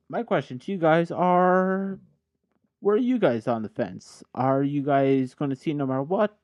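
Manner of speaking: ordinary delivery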